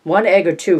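wife asking her husband about breakfast from downstairs; calling out. A narrator's reading. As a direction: shout the breakfast question up the stairs. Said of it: "Rachel called".

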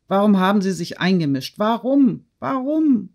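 high-pitched outcry without extra volume; narrated cry. A narrator's reading. Girl demanding why the woman interfered, high-pitched protesting cry. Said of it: "schrie Natalie".

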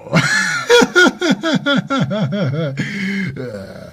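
big shouting laugh. Acted. Host bursts out laughing after the song, shouting laugh.

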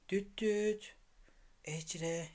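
soft low call to wake them. Real, not acted. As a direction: call the name gently and quietly. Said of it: "Onları sessizce uyandırayım".

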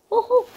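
sharp high "ooh" at sour taste; high sour squeal. Real, not acted. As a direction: squeal as the sourness hits. First taste of sour candy.

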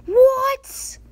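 high, loud squealed disbelief; real startled squeal; shocked outburst. Real, not acted. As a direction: blurt it out in sudden shock. Startled reaction to the game.

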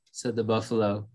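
plain reading voice

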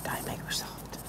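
a hushed mock-menacing whisper; whispered threat. Sneaking toward the rat, whispered mock menace. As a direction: breathe the threat, barely voiced.

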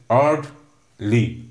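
"Orderly" slowly, in two separate beats; split into syllables. Shown as a demonstration. Teacher counting two syllables for students, "Orderly" slowly broken up. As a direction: pause between the syllables of "Orderly". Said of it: "So there are two".

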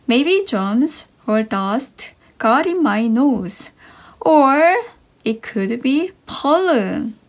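ordinary talk, nothing marked

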